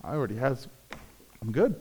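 a quiet, easy no thanks; hushed, satisfied shrug in the voice; mock content refusal. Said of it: "You're like".

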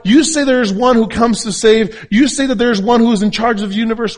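high, loud, emphatic sermon delivery; full-force preaching. Real, not acted.

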